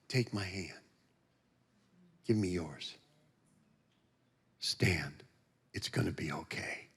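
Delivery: very soft, low and unhurried comfort; hushed, low, consoling voice; gentle reassurance. Retold or imitated. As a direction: speak tenderly, almost whispered, with long pauses. Jesus voiced, reassuring him.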